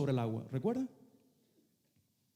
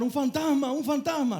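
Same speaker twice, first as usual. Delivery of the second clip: frightened shouting; 7 semitones higher than usual. Retold or imitated. Fishermen shout at the figure on the water, high mock panic. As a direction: yell it like sailors seeing a ghost. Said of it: "comenzaron a gritar".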